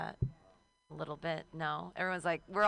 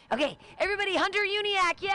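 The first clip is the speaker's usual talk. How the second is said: loud cheering intro, much higher; host hype shout; 13 semitones higher, 8 dB louder than usual. Real, not acted. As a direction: bring the next comic up with a roar. Host introducing next comic.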